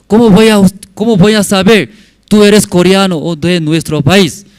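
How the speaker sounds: high, loud acted challenge; sceptical challenge. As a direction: challenge the caller, playing the president. Acts president challenging caller's nationality.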